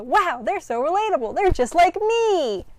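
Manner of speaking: high, slow gushing fan voice; overexcited, dragging out me; mock gushing.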